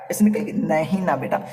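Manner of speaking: ordinary talk, nothing marked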